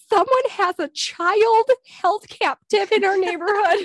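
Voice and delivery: high, loud mock alarm; alarmed neighbors. Neighbors alarmed to the cops, mock panic. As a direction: sound panicked like the worried neighbors. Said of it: "the neighbors are like".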